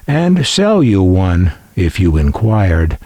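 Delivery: normal talking voice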